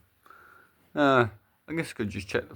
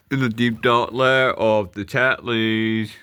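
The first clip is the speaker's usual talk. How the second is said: slow dramatic narrator. Acted. Dramatic scene announcement.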